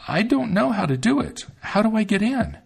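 put-on a girl feigning not to know; Gretel playing dumb. A narrator's reading.

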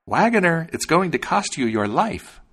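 high, loud sparrow's threat; crying out. A narrator's reading. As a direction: cry it out high, as the defiant sparrow. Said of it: "stuck out his head and cried".

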